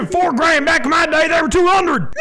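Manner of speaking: high, hammed-up Southern old-timer grumble; Southern accent. Player griping about prices back in his day, mock Southern accent. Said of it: "Warzone in the South, be like".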